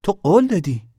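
high, loud reproach; angry accusation. A narrator's reading. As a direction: accuse him of breaking faith, loudly. Girl's angry reproach in quarrel.

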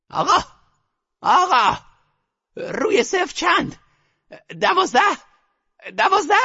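put-on rustic-accented grandmother. A narrator's reading. Grandmother questioning the croupier in her rustic accent. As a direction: call the croupier like a country grandmother. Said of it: "با لهجهٔ دهاتی وارش".

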